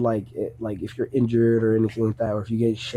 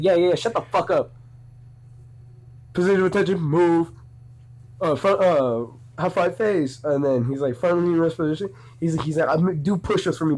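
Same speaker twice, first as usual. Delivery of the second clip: mock drill commands; 5 semitones higher than usual. Sergeant barking drill commands.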